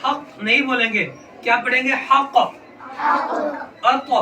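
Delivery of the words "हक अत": हक and अत with the final consonant bounced back; qalqala bounce. Shown as a demonstration. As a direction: let the त in अत rebound off its point of contact. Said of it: "आवाज double आएगी टकरा के वापस".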